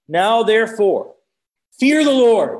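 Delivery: emphatic Bible verse, voice lifted; forceful scripture reading. Acted. First, less booming scripture reading.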